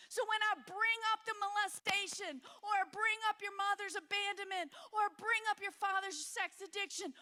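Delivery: loud, high, impassioned preaching; God preaching through her. Retold or imitated. God confronting her buried pain, preached loud and urgent. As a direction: proclaim it as God pleading and urging. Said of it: "And he's like".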